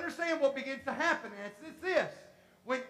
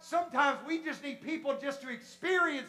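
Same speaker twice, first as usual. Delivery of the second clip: loud, rising sermon climax; full-force preaching; 4 semitones higher, 8 dB louder than usual. Real, not acted. Preacher urging the church to experience God, building to a shout.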